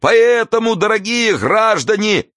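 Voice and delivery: high-pitched, slow oratory with drawn-out words; stretched, ringing public speech; impassioned street orator. A narrator's reading. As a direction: harangue the crowd at a high pitch.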